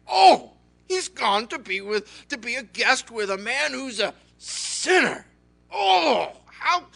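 loud, high, scandalized groan; hammed-up indignation; outraged complaining. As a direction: complain like an appalled, livid crowd. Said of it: "they all complained".

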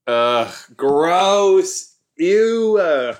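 loud disgusted groan; grossed out, loud and high; annoyed ugh. Real, not acted. Disgusted reaction to show scene.

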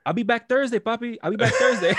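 high, loud imitation verging on laughter; emphatic retold promise. Detainee promising the officer he'll return, emphatic. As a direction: repeat it insistently, about to crack up.

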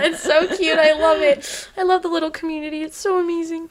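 high, excited gushing with laughter. Real, not acted. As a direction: squeal about how cute it is. Gushing over the show.

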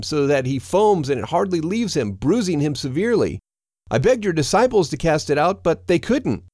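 high-pitched, pleading father; pleading father calling out. A narrator's reading. Father begs the teacher for his only son, high pleading. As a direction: beg for help, pitched well up. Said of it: "A man from the crowd called out".

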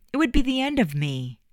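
high, heartfelt overstatement; dramatic. Acted. Eddie pleading with Juliet not to leave, melodramatic. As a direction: pour it on like a lovesick hero. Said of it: "Eddie and his dramatics".

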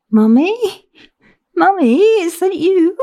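put-on high, plaintive little-girl voice; lost child asking, voice lifting sharply; childlike daughter. A narrator's reading. Dazed daughter calling for her mother on the phone, childlike.

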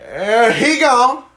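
very high, loud and slow; teasing the drunk as he's thrown out, drawn-out high call; mock sing-song call. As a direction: stretch it out like a cartoon farewell. Joking about the drunk leaving.

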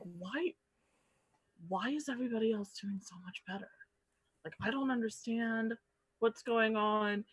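hushed, frustrated whisper; a confused player whispering under her breath. Retold or imitated. Frustrated newcomer retold.

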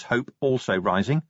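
ordinary delivery

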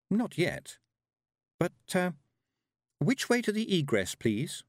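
high, lightly voiced young girl; polite girl asking up high. A narrator's reading. Tiffany answers Death.

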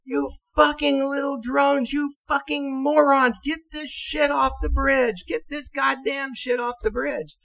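very high, furious screamed insults; screaming at the top of his voice. Retold or imitated. Captain screaming insults at the bridge crew. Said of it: "screaming at the top of his voice".

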